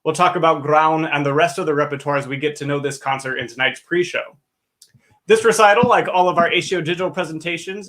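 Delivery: normal talking voice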